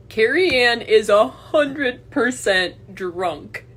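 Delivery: slow, loud, high gloating; gleeful gloating. Real, not acted. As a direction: savor every word, gloating. Mocking gleeful declaration.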